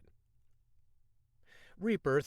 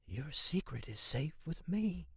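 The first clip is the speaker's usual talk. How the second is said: soft, hushed conspiratorial voice; whisper; 4 semitones higher, 5 dB quieter than usual. A narrator's reading. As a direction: lean in and whisper it. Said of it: "talked in a whisper".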